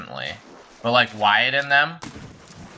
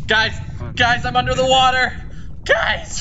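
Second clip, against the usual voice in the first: panicked; 12 semitones higher than usual. Real, not acted. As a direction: cry for help from underwater. Panicking while drowning in game.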